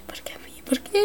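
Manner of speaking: hushed, breathy why-me; whispered lament. Real, not acted. Gamer after dying, whispering a lament. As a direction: whisper the self-pity.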